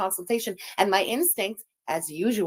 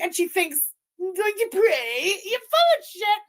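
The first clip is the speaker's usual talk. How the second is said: mocking; 8 semitones higher than usual. Mocking the woman, then scoffing.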